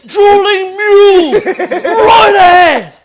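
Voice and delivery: long, high bellowed call; mock lookout shout. Cook riffs on the sinking Titanic, mock lookout shout. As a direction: stretch each warning out at full volume.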